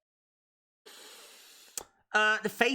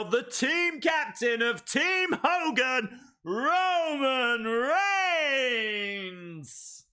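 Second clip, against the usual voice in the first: announcer call; 6 semitones higher, slower than usual. Pretend. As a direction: announce it to the arena. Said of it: "we better say it quickly now".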